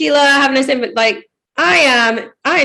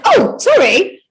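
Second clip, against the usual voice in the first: high, louder mock-startled apology; 10 semitones higher, 5 dB louder, much slower than usual. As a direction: imitate a flustered host caught out, pitched well up. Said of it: "And she was like".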